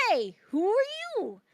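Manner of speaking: high, punchy character greeting; calling out in character. Acted. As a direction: call out high and cartoonish. Happy calls out to two oranges.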